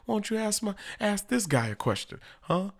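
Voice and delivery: high-pitched, indignant mock refusal; comic high-voiced brush-off; mimicked Trump. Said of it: "he was like".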